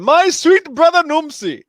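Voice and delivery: a hint of a slow, loud, high movie-line delivery; lightly voiced movie quote; quoting the movie. Quoting Golden Child to a friend, lightly acted. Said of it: "he said".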